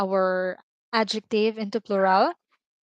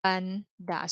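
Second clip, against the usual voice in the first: slowed breakdown; much slower than usual. Shown as a demonstration. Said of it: "maganda becomes".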